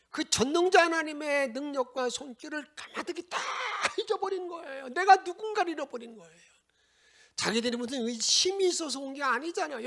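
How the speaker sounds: loud, high fervent preaching; fiery sermon peak; preaching at full force. Real, not acted. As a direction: build it to a shouting climax. Preaching climax.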